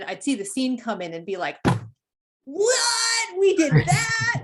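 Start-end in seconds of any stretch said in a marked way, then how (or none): 2.4-4.4 high, loud astonished outburst; drawn-out, high-pitched cry of disbelief; amazed disbelief. Retold or imitated. Retells his amazed reaction to scene.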